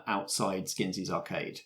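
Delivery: ordinary talk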